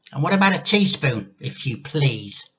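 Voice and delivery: grandma demands tea from George, bossy and cranky. Acted. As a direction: order the boy about like a cranky old lady. Grandma voiced in story.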